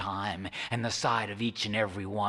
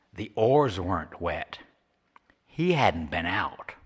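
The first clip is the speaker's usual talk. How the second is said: ordinary delivery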